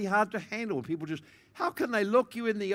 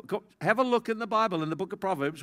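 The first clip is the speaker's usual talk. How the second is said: ordinary delivery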